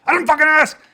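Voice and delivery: acted shout of a hostile stranger; furious shout. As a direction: explode at him like a street thug. Acted aggressor explodes.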